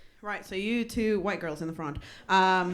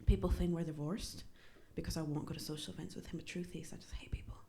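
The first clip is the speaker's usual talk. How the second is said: softer, dropping to a whisper at the end; mom's confession; 4 semitones lower, 7 dB quieter than usual. Retold or imitated. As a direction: let it sink to a secret whisper. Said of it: "My mom goes".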